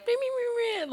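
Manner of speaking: high mocking little meh-meh voice; mimicked pleading girls. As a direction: squeak like wheedling girls, mockingly.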